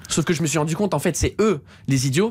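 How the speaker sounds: ordinary talk, nothing marked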